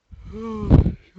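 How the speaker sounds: long loud yawn; big yawn. Real, not acted. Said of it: "Sorry about the yawn".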